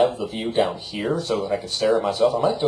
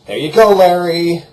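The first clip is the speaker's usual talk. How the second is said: loud, higher call-out; calling out to subscriber Larry, loud and bright; 7 semitones higher, 11 dB louder than usual. Real, not acted. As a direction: announce it like a presenter.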